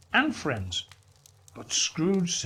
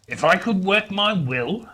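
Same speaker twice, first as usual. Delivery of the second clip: loud, high indignant outburst; 5 semitones higher, 5 dB louder than usual. A narrator's reading. Scrooge fuming at his nephew, indignant and loud. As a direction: rage at the very idea. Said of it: "said Scrooge indignantly".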